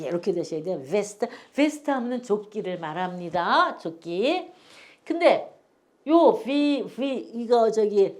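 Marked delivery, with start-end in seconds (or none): none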